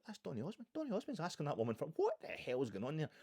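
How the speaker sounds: a viewer stunned by the video, mock shock; shocked disbelief. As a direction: react like you can't believe your eyes. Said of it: "you're like".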